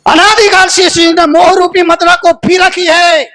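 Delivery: high, ringing declamation; sermon cry, pitched right up; impassioned preaching. Real, not acted. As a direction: proclaim it to the congregation on a high held pitch.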